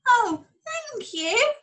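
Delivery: high, slow grateful sheep voice. Acted. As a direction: sigh out thanks like a rescued sheep. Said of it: "she said".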